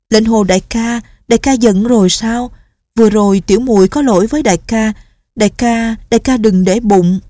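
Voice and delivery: pleading so he won't be angry; young nun, anxious apology. Acted. (giọng cô gái cao, lo lắng xin lỗi)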